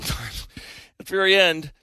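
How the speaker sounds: ordinary talk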